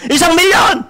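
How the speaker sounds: shouting big numbers to rehearse; practice announcement shout. Pretend. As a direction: belt it like a stage host practicing at home. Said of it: "Teka, mag-practice ako dito".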